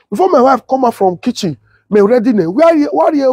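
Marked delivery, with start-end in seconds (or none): 2.5-3.3 mock calling out. Calling out to someone at home.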